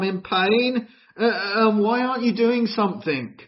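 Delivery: high, anguished pleading to God; a hurting believer crying out for help; desperate prayer. Retold or imitated. Believer in pain pleading with God, anguished. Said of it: "you said to God".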